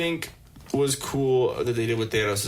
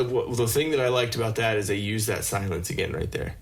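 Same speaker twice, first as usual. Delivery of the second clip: ordinary talk, nothing marked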